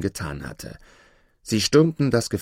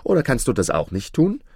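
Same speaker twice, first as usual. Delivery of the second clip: louder, higher, pressing impatience; impatient; 5 semitones higher, 4 dB louder than usual. A narrator's reading. Aladin pressing the dwarf for his castle, impatient. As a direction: push the demand, pitched up. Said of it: "entgegnete Aladin ungeduldig".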